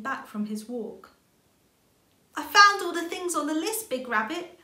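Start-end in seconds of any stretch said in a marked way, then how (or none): 2.3-4.6 high, loud and eager little rabbit; bursting with news for grandpa; excited. A narrator's reading. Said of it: "she said excitedly".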